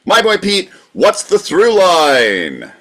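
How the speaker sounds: high, loud host call with a slow drawn-out question; game show host. Pretend. [name] introducing the through line game like a host. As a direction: announce it like a quiz host.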